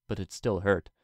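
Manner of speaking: ordinary delivery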